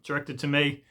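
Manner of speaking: ordinary delivery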